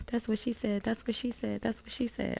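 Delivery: high, giddy teasing repeat; playful high-pitched running gag; jokey catchphrase. Acted. Giddy repeated catchphrase joke from The Office.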